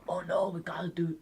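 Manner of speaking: high, breathy whispered panic; Nicolas Cage. Acted. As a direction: imitate a breathy film star. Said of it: "He's very breathy".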